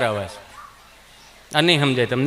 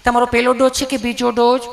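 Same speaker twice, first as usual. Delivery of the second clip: a lightly voiced nurse asking casually; sweet nurse; 3 semitones higher than usual. Nurse asks Gabho about his dose, lightly sweet. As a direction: ask gently like a friendly nurse. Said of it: "nurse બેને સહજતાથી પૂછ્યું".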